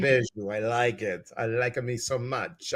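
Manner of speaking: hammed-up Italian accent, dropping down; put-on Italian accent. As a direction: slip into playful Italian-accented English. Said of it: "I'm making an Italian accent".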